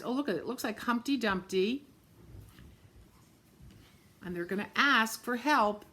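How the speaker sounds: ordinary delivery, nothing marked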